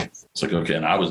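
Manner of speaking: normal talking voice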